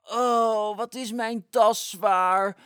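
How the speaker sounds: heavy sighing plea, loud and dragged out. Acted. Flop sighs asking Sas to help lift the heavy bag, strained and sighing. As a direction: groan under the weight of a bag. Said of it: "zuchtte Flop".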